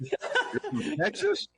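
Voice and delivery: high-pitched, drawn-out incredulous question; amused mock astonishment; mock shocked disbelief. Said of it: "this scared look on their face".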